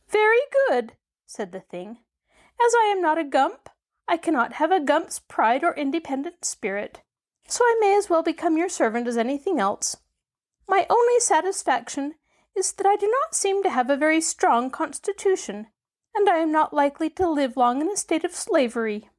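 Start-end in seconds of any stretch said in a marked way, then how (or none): 0.1-1.0 high squeaky creature voice; tiny squeak from a huge beast; squeaky gump voice. A narrator's reading. Newly awakened gump marvelling at itself, squeaky voice. 2.6-19.1 high squeaky creature voice; tiny squeak from a huge beast; squeaky gump voice. A narrator's reading. Newly awakened gump marvelling at itself, squeaky voice.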